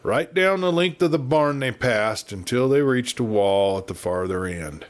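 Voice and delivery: ordinary delivery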